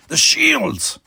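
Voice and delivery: high, loud panicked cry; alarmed cry. A narrator's reading. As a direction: cry out as the shields fail. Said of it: "he cried".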